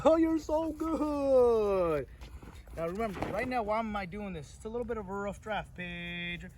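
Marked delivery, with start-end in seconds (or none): 0.0-2.1 high, slow, laughing praise for the dog; lavish the dog with delighted praise; gushing praise. Real, not acted. Trainer laughing and praising the dog, high and drawn out.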